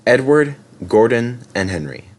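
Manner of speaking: ordinary delivery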